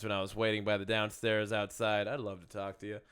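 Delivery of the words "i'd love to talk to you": normal talking voice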